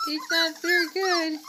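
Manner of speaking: normal talking voice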